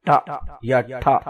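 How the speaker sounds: "ٹا" then aspirated "ٹھا" with a puff; the two ways to say t; t sound demo. Shown as a demonstration. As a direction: give "ٹا" and then breathy "ٹھا". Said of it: "اصل میں وہ اس کو ٹھا بولتے ہیں".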